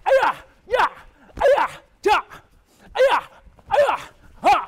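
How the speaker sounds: rapid high kiai yells; kiai shouts. Shown as a demonstration. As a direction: shout each move you make.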